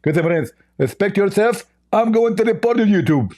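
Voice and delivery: high, lisping mock indignation; Zakir Naik. Mock preacher threatening to report the host, high and lisping. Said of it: "Hey, Zakir Naik".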